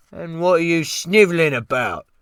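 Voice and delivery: high, loud Cockney outburst; renewed rage. Acted. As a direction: burst out at him in fury. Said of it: "he burst out at me with a renewed rage".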